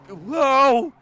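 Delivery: loud, high, drawn-out whoa; startled whoa. Real, not acted. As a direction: yelp in sudden alarm. Startled by near crash in race.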